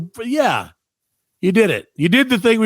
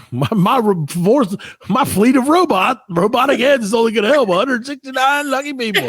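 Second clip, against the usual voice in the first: robot overlord; 7 semitones higher than usual. Pretend.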